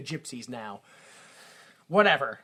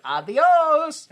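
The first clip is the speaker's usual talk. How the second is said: high, loud cheery farewell; monkey voice; 11 semitones higher, 8 dB louder than usual. Retold or imitated. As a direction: shout it like a silly monkey. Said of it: "the monkey's like".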